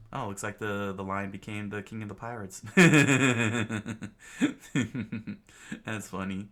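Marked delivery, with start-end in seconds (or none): 2.7-5.4 big loud burst of laughter. Real, not acted. Laughing at own reading.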